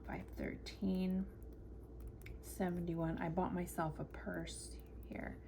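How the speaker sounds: whispering. Real, not acted. Whispering while entering amounts.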